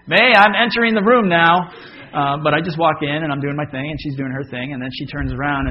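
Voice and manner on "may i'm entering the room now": higher, louder mock formal announcing; mock announcement. Husband pretending to announce himself to his wife, mock formal. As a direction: announce yourself with mock ceremony. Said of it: "go into the room and say".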